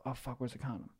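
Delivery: soft, muttered alarm; half under the breath, worried; a mild mock fright; hushed retold panic. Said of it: "and I go".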